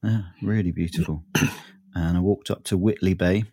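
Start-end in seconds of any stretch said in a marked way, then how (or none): none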